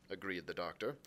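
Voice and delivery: plain reading voice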